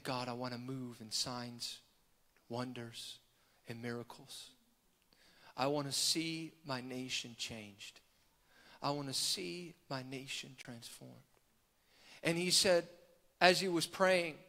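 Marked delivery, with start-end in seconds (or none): 0.0-11.1 very soft, low pleading prayer; hushed prayer. Retold or imitated. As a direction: pray quietly to God, letting phrases sink. Retold prayer while pacing and rocking.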